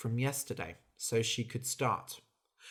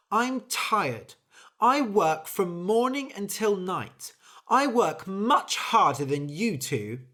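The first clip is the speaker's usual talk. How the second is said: louder, higher, exasperated; fed-up complaint; 7 semitones higher, 6 dB louder than usual. Acted. Bird complaining to mouse and sausage, exasperated. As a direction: vent the unfairness of it all.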